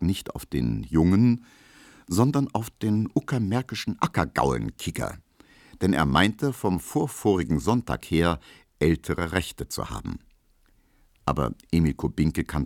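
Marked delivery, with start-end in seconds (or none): none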